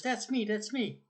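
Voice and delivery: put-on high, slow, eager little kid pointing at a photo; excited child voice. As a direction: squeal it like a small child who found himself.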